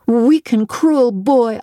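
put-on a girl's slow, furious rebuke; indignant retort. A narrator's reading. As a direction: spit the words at the bully. Jane finally answers back.

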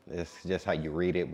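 usual speaking voice

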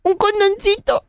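put-on very high little boy voice; high-pitched innocent child; four-year-old boy. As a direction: squeak it out like a small child. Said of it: "¿Y qué me pediste?".

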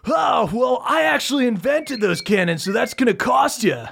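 Funky upselling his cannons to the crew; surfer salesman. Acted. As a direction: hustle them for the cannon. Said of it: "he goes".

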